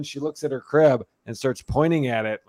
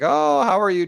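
put-on higher, louder cooing to a toddler; sweet parent voice; 5 semitones higher, 5 dB louder than usual. Mom greeting her cheery daughter after a nap, sing-song coo. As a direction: coo it like waking a happy baby. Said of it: "And I was like".

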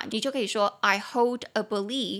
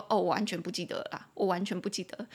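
lower, softer, fast I don't remember at all; blunt quick admission; 4 semitones lower, 4 dB quieter, faster than usual. Retold or imitated. Teacher voicing someone who bluntly admits forgetting, low and quick. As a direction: toss it off bluntly. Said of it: "你其实就是等于是在说".

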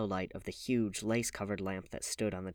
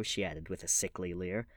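ordinary delivery, nothing marked

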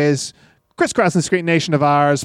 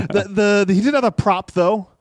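ordinary talk, nothing marked

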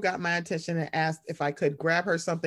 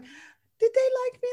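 high mother voice; 3 dB louder, slower than usual. Retold or imitated. Daughter voicing her anxious mother.

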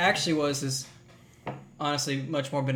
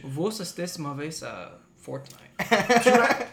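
Spanish-sounding French; 3 semitones higher, much slower than usual. Shown as a demonstration. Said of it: "I can't do the French accent".

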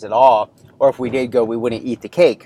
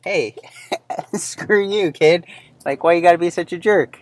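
mock offended retort; 7 semitones higher than usual. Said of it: "And it's like".

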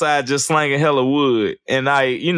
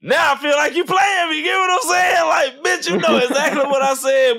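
mock outraged shout; 12 semitones higher than usual. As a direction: yell it like you've been hustled, half joking. Mock outrage at being played.

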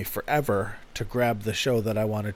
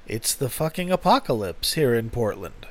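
ordinary talk, nothing marked

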